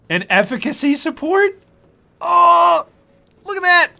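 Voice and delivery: high, loud, incredulous delight; amazed excitement. Real, not acted. Streamer reading out a great item's bonuses, amazed excitement. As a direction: exclaim each discovery in a high, loud voice.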